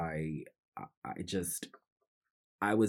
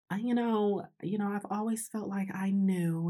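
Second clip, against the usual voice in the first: high-pitched put-on mother voice; mom impression; 13 semitones higher than usual. Mom saying she always felt she knew, high mock-mom voice. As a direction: imitate mom in a much higher voice.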